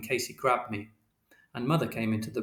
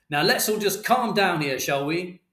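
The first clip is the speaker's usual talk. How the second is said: raised, higher-pitched voice for order; shouted appeal; 7 semitones higher, 3 dB louder than usual. A narrator's reading. Mister Shears to quarrelling parents, shouting. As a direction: call for calm over a row. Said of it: "And Mister Shears shouted".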